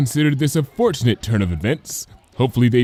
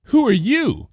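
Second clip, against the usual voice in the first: blunt question; 13 semitones higher, 3 dB louder than usual. Acted. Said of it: "Cheerilee asked bluntly".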